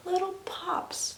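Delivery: slow, whispered little detail; hushed whisper. Retold or imitated. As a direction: whisper it slowly, very small. Imitating tiny pops underhand.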